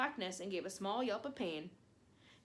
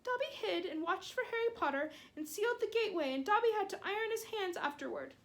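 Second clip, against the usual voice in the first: high, squeaky elf voice, begging; a small elf scolding his hero; pleading house elf; 11 semitones higher than usual. Acted. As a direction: house elf, high and anxious, reproaching Harry.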